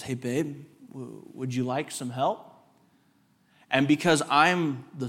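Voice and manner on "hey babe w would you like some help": sweetly soft offer. Retold or imitated. Wife gently offers help.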